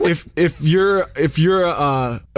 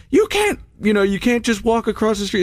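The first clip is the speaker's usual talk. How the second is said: much higher, squeaky mock scold from the traffic lady; 7 semitones higher than usual. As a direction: play the fussy traffic warden scolding a jaywalker.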